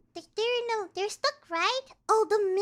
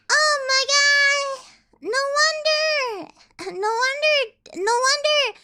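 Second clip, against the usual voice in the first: excited realization; 6 semitones higher, 5 dB louder than usual. Real, not acted. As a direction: shout it as it suddenly clicks. Realizes orcs' power.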